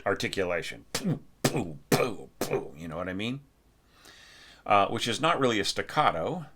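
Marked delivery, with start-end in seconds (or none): 0.9-2.7 punchy poo. Shown as a demonstration. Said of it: "a punchy kind of articulation".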